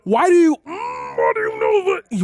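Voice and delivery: high, loud shout of disbelief; outraged demand. Real, not acted. Outraged outburst at mocking clip.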